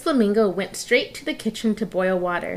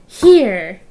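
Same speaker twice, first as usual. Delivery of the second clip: triumphant find; 11 dB louder than usual. Acted. Finds something, triumphant.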